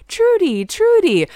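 high-pitched imitation of eager suitors; hammed-up high greeting; mock delighted greeting. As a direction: call the name high, as if thrilled. Both men turn and greet her.

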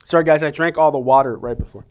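talking between sips of coffee; while sipping a drink. Real, not acted. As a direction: speak with a sip of coffee in your mouth. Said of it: "Excuse me as I sip on this coffee".

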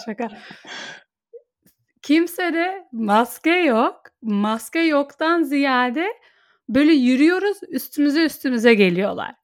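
ordinary delivery

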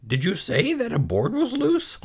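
put-on high, lonely young pig voice; high-pitched bored piglet; Wilbur the pig. Wilbur asks the goose.

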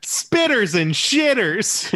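high, very drawn-out villain line; Malkovich attempt. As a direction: stretch it out high, hammed-up. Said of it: "We all have terrible Malkoviches".

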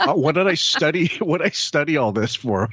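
mock dismay, laughing between phrases; disillusioned college kid. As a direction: lightly voice a let-down journalism student. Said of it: "all these college kids who".

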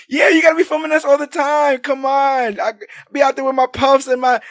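high, animated New York bragging; hammed-up street hype, riffing to the crew; hyped-up banter. Turtles showing off for April's camera, hyped-up imitation.

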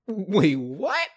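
loud, high incredulous wait, what; can't believe what he just heard; shocked disbelief. Retold or imitated. Busboy reacting to the shimmy request, loud and incredulous.

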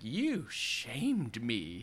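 very slow, drawn-out accusation; acted menacing reproach; vengeful old woman. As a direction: stretch each word like a wronged crone. Voicing the cursing old woman.

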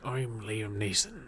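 low, slow, quiet Irish-tinged American try; a hint of an actor faking American; Liam Neeson. Mocking actor's accent for cohost, quiet low impression. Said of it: "Liam Neeson trying to do his American accent".

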